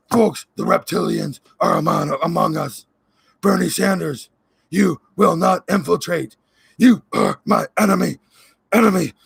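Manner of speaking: lightly voiced ranting conspiracy host; a hint of a frantic Alex Jones; Alex Jones impression. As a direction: play a paranoid talk-show ranter losing it. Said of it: "was that a decent Alex Jones".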